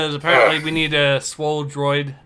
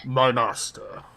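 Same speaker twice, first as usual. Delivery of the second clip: Vader voice; 3 semitones lower, 5 dB quieter than usual. Acted. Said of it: "since I'm voicing Vader".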